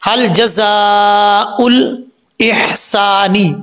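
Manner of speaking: slow, drawn-out chant; Quranic recitation. Shown as a demonstration. Teacher reciting the next verse, slow chant. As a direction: recite it slowly and melodically. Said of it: "recites the verse".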